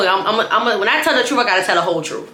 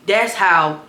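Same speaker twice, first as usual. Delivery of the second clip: usual speaking voice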